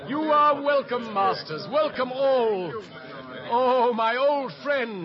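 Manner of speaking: loud, slow, hearty greeting; hearty welcome. Acted. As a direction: welcome them with open arms. Greeting arriving players loudly.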